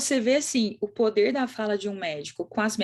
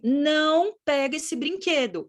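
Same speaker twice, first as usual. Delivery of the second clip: put-on slow, raised-pitch command to a child; drawn-out "don't touch" parental order; parent's warning; 5 semitones higher, slower than usual. Shown as a demonstration. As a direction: spell out the ban slowly, pitched up. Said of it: "Quando você fala assim".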